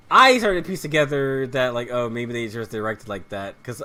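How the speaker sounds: ordinary talk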